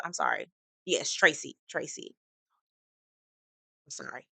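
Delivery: soft, low mumbled correction; quiet apology. Real, not acted. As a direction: drop to a murmur to correct yourself. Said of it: "correcting name, apologising".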